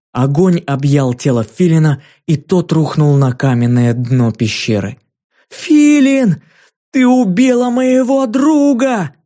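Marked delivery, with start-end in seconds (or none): 5.5-9.1 frantic scream. A narrator's reading. Ki screaming over her fallen friend, much higher frantic cry. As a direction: scream out in grief, high and drawn-out. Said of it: "истошно закричала Ки".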